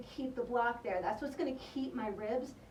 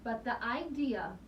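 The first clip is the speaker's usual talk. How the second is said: ordinary delivery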